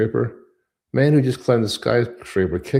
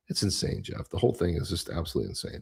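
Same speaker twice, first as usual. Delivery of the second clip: hushed disbelief; 3 semitones lower, 9 dB quieter than usual. Real, not acted. Host hushing his voice over the fraud. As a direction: drop to a near whisper, stunned.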